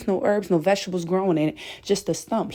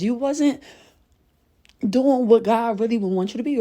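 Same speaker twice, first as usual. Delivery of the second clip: ordinary delivery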